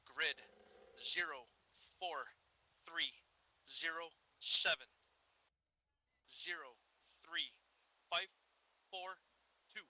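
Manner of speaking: loud digits, each one on its own; deliberate radio dictation with a pause after every digit; slow, spaced-out dictation. Real, not acted. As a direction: call out the grid one number at a time.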